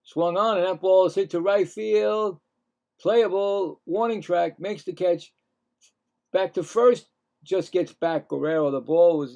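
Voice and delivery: excited baseball commentary; play-by-play. Acted. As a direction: call the hit like a radio announcer. Live play-by-play of ballgame.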